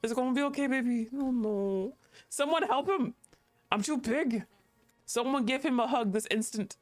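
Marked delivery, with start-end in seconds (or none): none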